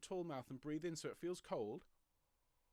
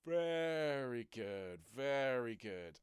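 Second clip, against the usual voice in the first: slow, drawn out through a yawn; 2 semitones lower, 5 dB louder, much slower than usual. Real, not acted. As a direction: say it mid-yawn. Yawning while praising.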